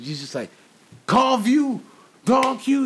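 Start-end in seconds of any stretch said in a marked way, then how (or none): 1.1-2.8 put-on high drunk callout. Imitating drunk [name] calling out.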